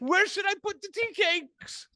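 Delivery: mock chipper. Mocking his own entrance to the sad table, high mock chipper call. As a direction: sing it out, oblivious and cheerful.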